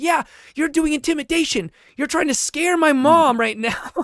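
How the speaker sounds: put-on accusing kid. The kid accusing him of scaring his mom, high child voice. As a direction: play the little boy defending his mom. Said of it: "the kid said".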